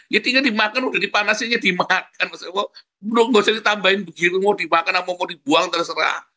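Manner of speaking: high, rapid exasperated retelling; exasperated retort. Retold or imitated. Parent snapping at spouse over reheated food, exasperated.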